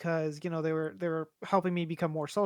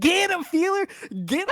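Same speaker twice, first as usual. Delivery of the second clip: high, loud, goofy yell at a teammate; dumb gamer yelling; 11 semitones higher, 5 dB louder than usual. Retold or imitated. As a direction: imitate a dumb player screaming orders, pitched way up. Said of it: "some dumb motherfucker going".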